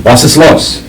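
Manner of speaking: high, loud German shout; shouted German question; loud German soldier. Acted. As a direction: bark it like a startled German guard. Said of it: "a German speaking loudly going".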